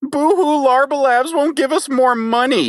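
high mock-sobbing whine; fake crying. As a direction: whimper it like a crybaby. Mocking whining funks holders.

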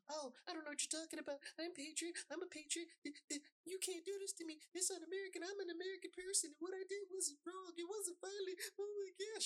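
high, fast, whining put-on voice of a protester; mock the rioter's high, fast whine of innocence; whiny mock rioter. Mocking a caught rioter's whining high voice.

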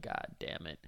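whispered under the breath; whispered dread. Retold or imitated. Comic facing a drunk biker crowd, whispered curse. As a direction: hiss it to yourself in dread.

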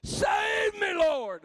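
full-throated high shout of save me; shouted cry for help. Shown as a demonstration. Preacher showing the church how to cry out, shouting. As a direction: scream it to the top of your lungs. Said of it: "to the top of your lungs".

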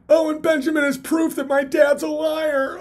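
high shrill mocking voice of a whiny hater; whiny mock critic. Mocking his envious haters in a whiny voice. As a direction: sneer it like a petty envious guy.

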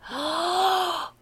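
gasp. Real, not acted. Reacting to thunderstorm news.

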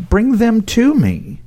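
slow firm insistence. Retold or imitated. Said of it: "And Jesus is like".

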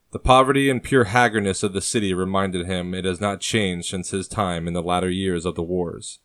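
plain reading voice

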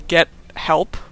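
ordinary talk, nothing marked

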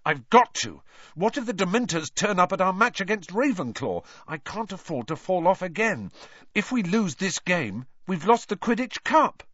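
high-pitched, urgent protest; fierce insistence. A narrator's reading. Boy pleading to go on before the match, fierce insistence. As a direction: argue fiercely, pitch climbing. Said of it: "said Harry fiercely".

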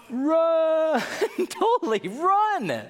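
high frantic cry to flee; panicked yell. Acted. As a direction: shout it like you're bolting away. Preacher acting panicked flight.

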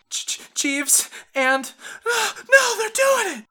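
very high, stammering, sobbing cry; panicked crying. Acted. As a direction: choke out the words in terror. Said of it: "crying a little bit".

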